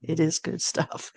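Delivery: quiet chuckle over the words; soft amused laugh; laughing softly. Real, not acted.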